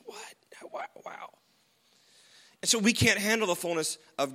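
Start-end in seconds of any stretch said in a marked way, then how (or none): 0.0-1.3 breathless, much higher whisper of awe; awestruck whisper. Retold or imitated. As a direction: gasp it out in wonder. Moses glimpses God's glory.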